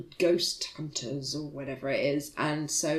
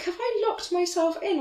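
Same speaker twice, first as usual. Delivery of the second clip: panicked; 12 semitones higher than usual. Retold or imitated. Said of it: "I was like".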